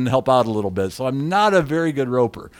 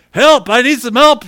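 higher, louder mock distress call; retold cry for help; 9 semitones higher, 9 dB louder than usual. Friend on the radio asking for help, calling out. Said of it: "I hear on the radio Chet saying".

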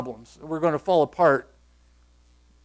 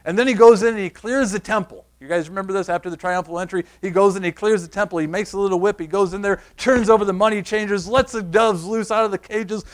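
ordinary talk

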